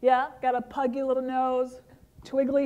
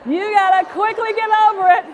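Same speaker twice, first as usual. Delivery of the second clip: laughing; 6 semitones higher, 8 dB louder than usual. Real, not acted. Laughing with crowd.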